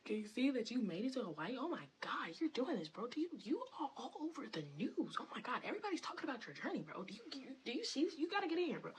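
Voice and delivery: high, excited hype-man gushing; hyping up. Retold or imitated. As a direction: pump him up like his biggest fan. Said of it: "I'm literally hyping your shit up".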